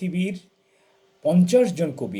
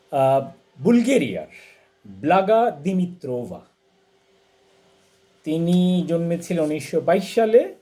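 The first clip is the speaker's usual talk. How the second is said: normal talking voice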